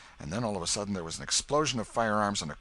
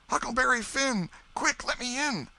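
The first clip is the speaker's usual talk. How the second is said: put-on high, urgent boy's plea; frightened boy; 6 dB louder than usual. Huck begs the Welshman at the door, frightened and high. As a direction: beg to be let in fast.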